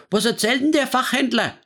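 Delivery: high, indignant Franconian woman griping; scolding. Acted. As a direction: huff at the gossip. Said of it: "schimpfte Frau Haas ins Telefon".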